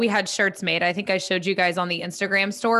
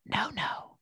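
soft hushed word; whisper; 5 semitones higher, 11 dB quieter than usual. Shown as a demonstration. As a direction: breathe the word out. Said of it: "I'm gonna whisper".

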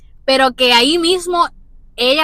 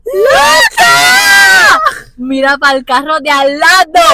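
very high, loud shriek of Loca, then a slower shout to look; excited shout; 6 semitones higher, 10 dB louder, much slower than usual. Retold or imitated. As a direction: yell to your friend to look at the next car. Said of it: "Leyanis me hace".

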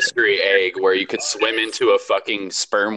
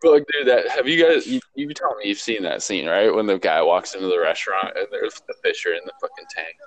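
ordinary delivery

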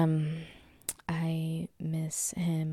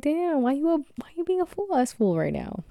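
high, loud mock outrage; exasperated scolding; 10 semitones higher, 5 dB louder than usual. Owner asking the cat why it's a fool, exasperated. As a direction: playfully berate a foolish pet, voice pitched way up.

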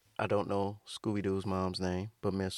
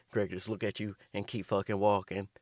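normal talking voice

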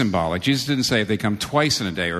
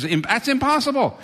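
incredulous exclamation. Acted. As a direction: voice jumps much higher on impossible. Disciples' exclamation voiced.

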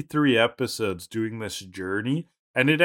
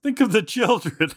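pleading mock voice; 8 semitones higher than usual. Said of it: "They're basically like".

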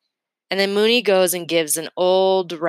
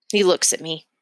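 plain reading voice

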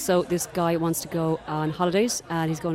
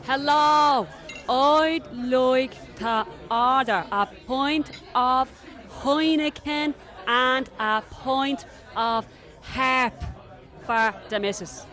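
put-on very slow, higher Dublin accent, loud on "Hello"; loud and slow for foreigners; 7 semitones higher, much slower than usual. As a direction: spell out the order to a barman who shouldn't understand. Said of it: "speak really loud and really slow".

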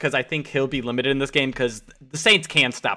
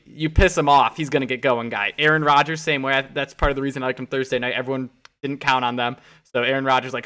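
ordinary talk

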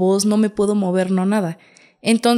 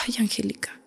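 breathy whispered sigh of a tired mother; mother's whisper; 11 dB quieter than usual. Retold or imitated. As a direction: whisper it like a mom half-asleep. Mother voiced in the story.

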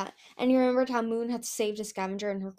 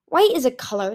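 louder, mildly testy retort; snapped; 3 semitones higher, 8 dB louder than usual. A narrator's reading. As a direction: snap it with mild irritation. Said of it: "he snapped".

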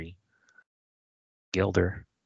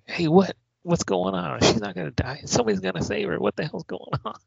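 high-pitched imitation of a kid interrupting; mock-indignant high kid voice; protesting kid; 11 semitones higher than usual. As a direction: play a kid objecting, voice pitched way up. Voicing alarmed kid interrupting the story.

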